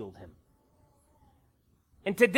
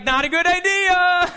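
put-on high, loud little-kid wail; raised, high mimicked child's cry; voiced alarmed child; 7 semitones higher, 5 dB louder than usual. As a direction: call it out like a worried kid watching TV.

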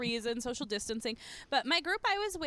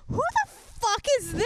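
shocked quote; 9 semitones higher, 3 dB louder than usual. Retold or imitated. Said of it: "And I was like".

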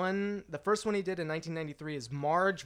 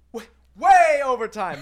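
loud, slow, mock-outraged; loud drawn-out protest; 5 semitones higher, 13 dB louder, slower than usual. Real, not acted. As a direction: stretch out way over time, loudly. Laughing protest at running overtime.

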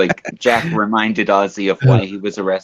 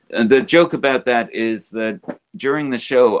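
ordinary talk

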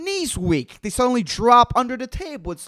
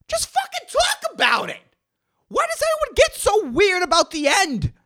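much higher, frustrated outburst; exasperated rant; 13 semitones higher, 4 dB louder than usual. Real, not acted. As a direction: plead with everyone to just talk. Ranting at audience.

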